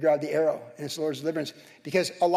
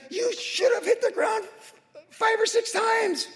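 high, slow, exasperated scolding; a dying prophet scolding the king, much higher and slow; mock angry rebuke; slower than usual. Preacher voicing Elisha rebuking the king, high slow exasperated scolding. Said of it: "He was mad".

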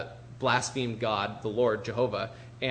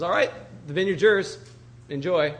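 breezy, higher-pitched handover with a punchy start; breezy triumph; 4 semitones higher, 4 dB louder than usual. Retold or imitated. Wife tells her husband the vineyard is his, breezy triumph. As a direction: imitate a wife announcing a prize, raised and louder.

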